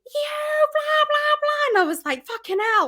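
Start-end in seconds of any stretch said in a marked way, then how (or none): 0.1-1.8 high mock yell of abuse; a much higher yelled imitation of a heckler; mock scream. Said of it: "screaming at me". 2.3-2.9 high startled curse; a higher shocked exclamation. Retold or imitated. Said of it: "it's a bit of a shock".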